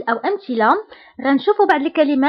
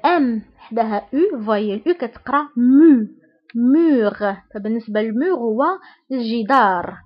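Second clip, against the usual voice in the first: normal talking voice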